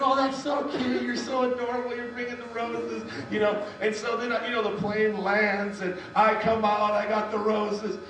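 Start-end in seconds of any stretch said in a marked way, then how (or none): 0.0-3.0 gushing stewardess. Retold or imitated. Stewardesses admiring his proposal roses, mock gushing. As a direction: imitate a stewardess cooing over something adorable.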